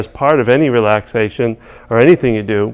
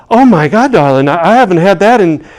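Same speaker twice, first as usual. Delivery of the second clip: high, loud, thrilled Southern lady; high loud elated old lady; excited old lady; 10 semitones higher, 7 dB louder than usual. Retold or imitated. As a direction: squeal it like a delighted old woman. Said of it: "she goes".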